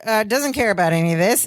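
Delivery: ordinary delivery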